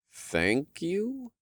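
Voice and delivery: unsure rising thank you; puzzled, questioning thanks; confused. A narrator's reading.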